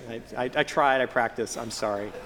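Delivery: ordinary talk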